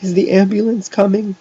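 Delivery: high, loud pained moan. A narrator's reading. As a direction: moan the question pitifully. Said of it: "Jeff moaned".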